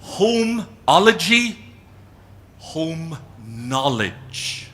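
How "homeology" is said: Homeology said high, loud and slow; Homeology stretched out and punched; emphatic coinage. Shown as a demonstration. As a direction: present Homeology slowly as a new word. Coining a word for the audience.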